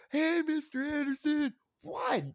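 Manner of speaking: put-on high child's call; kid calling out. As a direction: chirp it like a small boy.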